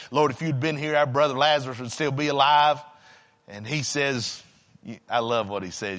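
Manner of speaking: low, softened scripture retelling; subdued preaching. Real, not acted. Preacher recalling Jesus at Bethany for congregation, subdued preaching. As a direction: bring the pulpit voice down low and confide.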